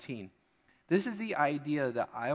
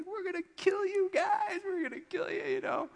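put-on very high childish boast; high squeaky kid taunt; excited boasting kid. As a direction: crow like a thrilled little boy. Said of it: "He's like".

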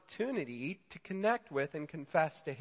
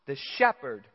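ordinary delivery

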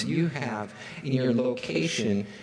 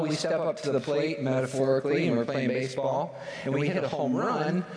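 ordinary talk, nothing marked

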